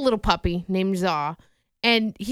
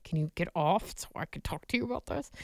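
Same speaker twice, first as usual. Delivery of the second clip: hushed demand; 3 semitones lower, 10 dB quieter than usual. Retold or imitated. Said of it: "I'm like".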